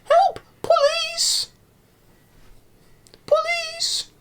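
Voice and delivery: hammed-up falsetto cry for help; mock yelling for police. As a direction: squeal for help like a frantic old woman. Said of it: "when she's yelling for police".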